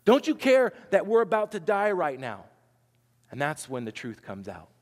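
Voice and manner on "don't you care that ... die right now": desperate cry. Retold or imitated. Disciples waking Jesus, crying out loudly in desperation. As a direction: wake him up with a desperate plea. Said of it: "they wake up Jesus and they say".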